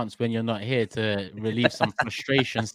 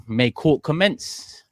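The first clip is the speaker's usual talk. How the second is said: mock court proclamation; 4 semitones higher, 4 dB louder, much slower than usual. Opening the show like a judge.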